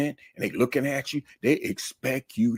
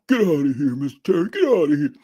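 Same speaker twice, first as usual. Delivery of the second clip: mock scoffing student, higher and louder; disbelieving student; 4 semitones higher, 5 dB louder than usual. Student waving off Mister Terry, loud mock scoff. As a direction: laugh it off like a student who won't believe you.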